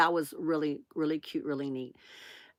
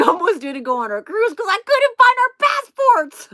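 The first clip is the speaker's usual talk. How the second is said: laughing confession; 12 semitones higher, 6 dB louder than usual. Real, not acted. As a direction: voice climbing high and loud with laughter. Laughing at own forgetfulness.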